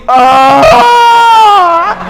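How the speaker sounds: long, loud, high "aah" of pain; imitated agonized wail. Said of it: "ele começou a fazer assim". (grito de dor imitado)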